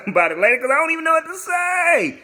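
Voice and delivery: very high, loud, rushed squeal; flustered squeal. Retold or imitated. Dodging his frat brother's question, flustered high squeal. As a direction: blurt it in a squeaky mock panic.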